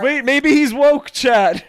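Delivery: high, loud, slow teasing call; drawn-out mocking jab at the man on screen. Friend teasing the drunk man on video, loud and slow.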